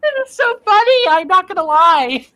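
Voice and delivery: barely holding back giggles; loud, high, giddy delight; amused, laughing. Real, not acted. Cracking up at the voice.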